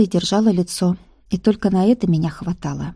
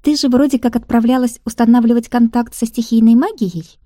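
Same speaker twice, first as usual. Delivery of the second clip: put-on higher, louder playful girlfriend voice; teasing friend; 5 semitones higher, 5 dB louder than usual. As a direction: tease her friend brightly.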